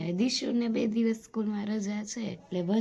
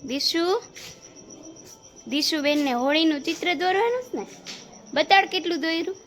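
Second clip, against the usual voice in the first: raised, high call across the house; 7 semitones higher, 4 dB louder than usual. Real, not acted. Mother calls Dishu to show her Holi drawing, calling out loudly. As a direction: call her over, louder and higher.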